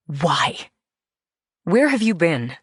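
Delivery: plain reading voice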